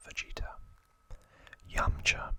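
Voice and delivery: usual speaking voice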